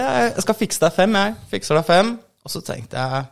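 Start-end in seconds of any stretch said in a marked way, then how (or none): 0.0-2.1 quoting comedian. Retold or imitated. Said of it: "Så sier han".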